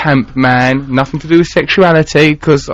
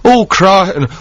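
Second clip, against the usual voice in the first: put-on Manchester accent; 3 semitones higher than usual. Teasing co-host about parents back home, in a mock Manchester accent. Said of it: "you've got your Manchester accent there".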